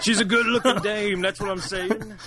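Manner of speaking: high, put-on old-fashioned fella voice; old-timey gangster voice. Co-host playing along in a put-on old-timey voice. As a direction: ham up a fast-talking thirties guy.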